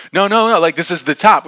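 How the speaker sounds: high, loud contradiction; mock insistence. Girl to the doubting group, loud insistence.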